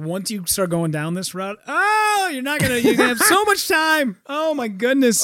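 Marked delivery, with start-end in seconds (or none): 1.7-5.2 high, hammed-up gushing; exaggerated wonder; mock amazement. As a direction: gush in a put-on high voice. Mock exclamation about so much time.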